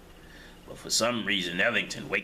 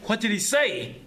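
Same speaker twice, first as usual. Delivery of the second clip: eager question; 11 semitones higher, 4 dB louder than usual. Acted. Man questions in the cell.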